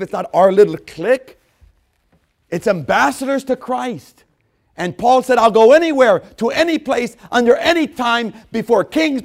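high, loud shouted pulpit cadence; preaching at full force. Acted. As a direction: drive each line harder.

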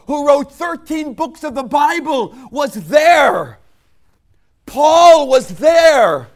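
very loud, high shouted preaching; fiery sermon climax; full-force preaching. Acted. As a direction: preach at the top of your voice.

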